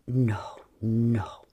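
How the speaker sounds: low whispered no; low whispered refusal. A narrator's reading. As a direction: breathe it low. Lover stopping her.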